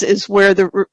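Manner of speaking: usual speaking voice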